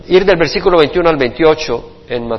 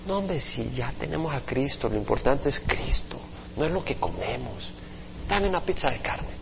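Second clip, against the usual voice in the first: hushed casual retort; 4 semitones lower, 15 dB quieter than usual. Acted. Believer defends ordering meat.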